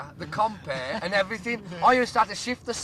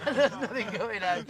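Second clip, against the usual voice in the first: laughing; 4 semitones higher, slower than usual. Real, not acted. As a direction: talk through laughter. Laughing at memory.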